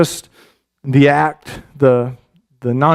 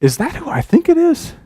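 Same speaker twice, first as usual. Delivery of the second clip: much higher, incredulous question; squinting disbelief; 12 semitones higher than usual. Retold or imitated. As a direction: peer into the distance, unsure. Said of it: "Saul kind of squinting, saying".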